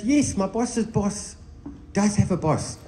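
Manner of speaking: slow, high, puzzled retelling; puzzled, confused. Retold or imitated. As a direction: answer as if the question makes no sense. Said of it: "like, really confused".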